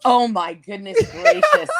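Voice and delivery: high, loud flustered exclaim; surprised. Real, not acted. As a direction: caught off guard, gasping it out. Asked to sing on air.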